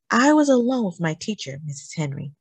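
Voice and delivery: usual speaking voice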